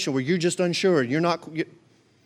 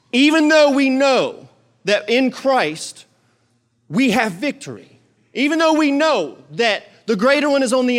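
preaching at full force; 6 semitones higher, 4 dB louder than usual. Acted. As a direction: preach it out to the congregation. Preaching point on authority.

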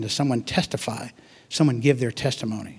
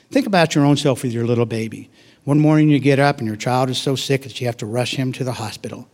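normal talking voice

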